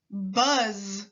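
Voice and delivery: "buzz." ending in a long voiced z; teacher stretching the final z. Shown as a demonstration. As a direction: buzz out the end of "buzz.". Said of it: "Repeat after me".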